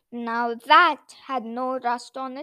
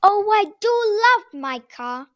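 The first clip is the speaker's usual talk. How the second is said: higher, louder, delighted Noddy; fond self-talk; 6 semitones higher, 9 dB louder than usual. A narrator's reading. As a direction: gush fondly to yourself about your car. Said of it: "Noddy said to himself".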